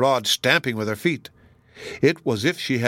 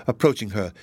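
plain reading voice

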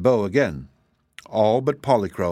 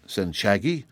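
usual speaking voice

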